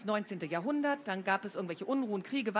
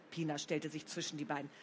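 usual speaking voice

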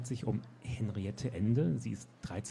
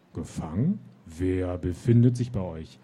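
booming, louder questioning voice; thundering voice; 9 dB louder than usual. Acted. Gatekeeper demands to know who the knights bring, booming. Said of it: "donnerte die Stimme".

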